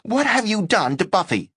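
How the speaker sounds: high, shrill-sounding cry of outrage; screaming roommate. Acted. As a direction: scream it at him over the dog. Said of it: "began to scream".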